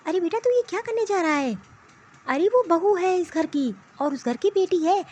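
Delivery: ordinary talk, nothing marked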